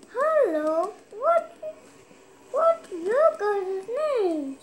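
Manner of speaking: high, slow puppet voice; dummy voice demo. Shown as a demonstration. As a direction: show how the dummy talks. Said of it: "That kind of stuff".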